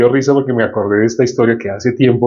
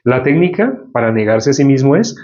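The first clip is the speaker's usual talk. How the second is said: ordinary talk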